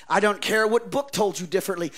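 normal talking voice

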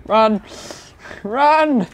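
very high, loud run; panicked shout. Real, not acted. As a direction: scream it while fleeing. Fleeing in panic.

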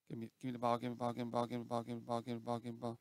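low, soft monotone chant; quiet, droning plea on one note; repetitive begging. Real, not acted. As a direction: mumble it over and over without a break. Begs for the ball over and over.

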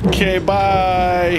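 high, stretched-out sign-off; drawn-out goodbye. Real, not acted. Player answers the narrator's farewell before being crushed, high drawn-out goodbye. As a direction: sing out a long, high goodbye.

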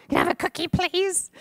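put-on high, sweet little-girl plea; imitating a little girl. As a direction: beg sweetly like a small child. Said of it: "That's how she said it".

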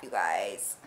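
low, soft, dismayed whisper; hushed dismay after a mishap; upset near-whisper. Real, not acted. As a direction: let it sink into a deflated whisper. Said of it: "I'm a little upset".

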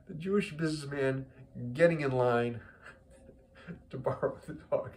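normal talking voice

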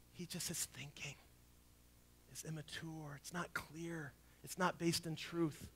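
soft. Real, not acted. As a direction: drop the voice to a gentle hush. Soft explanation after climax.

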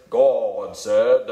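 stretched-out, put-on pulpit voice with a laugh; lightly mocking televangelist, laughing through it; mock preacher voice. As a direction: ham up a TV preacher dragging out God. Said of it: "when God is like four syllables".

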